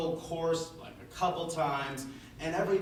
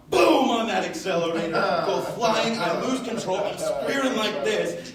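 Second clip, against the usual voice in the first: loud, high, rushing into disaster; excited crash telling; 5 semitones higher, 9 dB louder than usual. Retold or imitated. Student retelling his four-wheeler crash, loud and excited. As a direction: burst out with the runaway ride.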